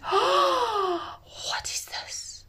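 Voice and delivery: high gasp then hushed wonder; astonished whisper. Acted. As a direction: whisper in amazement. Servant finds baby in basket.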